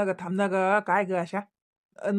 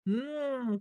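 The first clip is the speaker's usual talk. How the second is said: ordinary delivery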